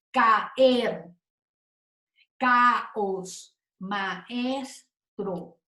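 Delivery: Caer, caos, maestro split as CA-ER, CA-OS, MA-ES-TRO; slow syllable-by-syllable caer, caos, maestro; hiatus, vowels separated. Shown as a demonstration. Teacher giving hiatus examples, vowels held apart. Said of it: "son separadas sonoramente".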